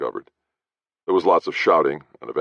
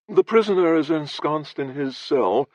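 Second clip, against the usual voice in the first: blasé near yawn; 14 semitones higher than usual. Acted. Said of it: "in a blasé, near yawn".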